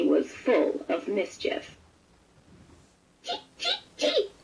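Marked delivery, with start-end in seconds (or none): 3.2-4.3 very high, soft squeaky cheeps; squirrel chatter. A narrator's reading. Red squirrel chatters back at the puppy, in high squeaks. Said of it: "the squirrel chattered back".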